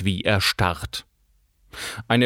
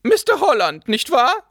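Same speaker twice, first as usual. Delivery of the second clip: attack before being exposed; brusque with hidden panic; 11 semitones higher than usual. A narrator's reading. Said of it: "Sie sprach so schroff". (schroff, mit unterschwelliger Panik)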